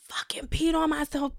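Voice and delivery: hushed, breathless confession; embarrassed whisper. Retold or imitated. Telling her friend on the phone she peed, in a whisper. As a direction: whisper it so nobody hears. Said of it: "And so I'm sitting up there like".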